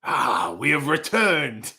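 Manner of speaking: slow, put-on grand declaiming; Egyptian epic acting. Performing Egyptian for a paying viewer, grand declaiming. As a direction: announce a return with slow pomp. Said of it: "That's me trying not to do an accent".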